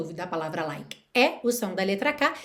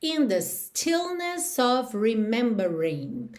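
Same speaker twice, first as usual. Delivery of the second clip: slow demo; slower than usual. Shown as a demonstration. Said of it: "teacher reads song line slowly".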